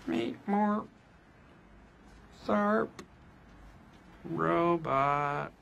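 halting, half-hearted robot voice; a weak, embarrassed robot impression. Shown as a demonstration. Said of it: "That's a terrible robot voice".

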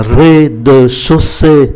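slow, syllable-by-syllable rez-de-chaussée; rez-de-chaussée read slowly for learners. Shown as a demonstration. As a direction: draw out rez-de-chaussée, separating each syllable. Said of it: "đọc chậm là".

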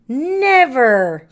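a loud, firm cry of refusal; cried out. Acted. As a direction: shout it down like a stubborn Santa. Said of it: "cried Santa".

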